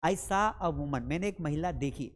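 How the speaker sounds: ordinary talk, nothing marked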